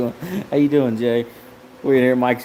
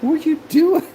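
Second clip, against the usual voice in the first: high teasing voice; 5 dB louder than usual. Acted.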